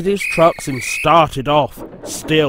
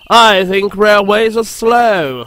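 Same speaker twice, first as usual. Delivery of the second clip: bored voice; 6 semitones higher, 5 dB louder, slower than usual. A narrator's reading. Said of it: "said Harold in a bored voice".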